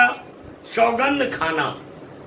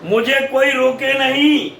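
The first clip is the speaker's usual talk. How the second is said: raised, high-pitched appeal; addressing everyone; 6 semitones higher, 3 dB louder than usual. Acted. Kaikeyi tells the assembly not to interrupt, high insistent address. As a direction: pitch it up to hold the crowd. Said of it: "सभी लोगों को संबोधित करते हुए".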